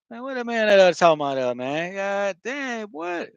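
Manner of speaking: high, rapid Florida slang; home-area Florida talk. Shown as a demonstration. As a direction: rattle it off like a Florida local. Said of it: "my area".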